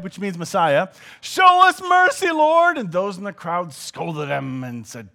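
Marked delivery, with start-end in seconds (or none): 1.2-2.8 loud, high desperate cry; acted shout. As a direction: cry out for help across a crowd. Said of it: "they shouted".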